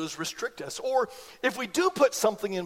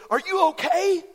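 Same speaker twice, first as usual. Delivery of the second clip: pitched-up worried brother; concerned brother; 9 semitones higher, 4 dB louder than usual. Retold or imitated. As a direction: mimic the brother's alarm. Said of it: "Kinda like that".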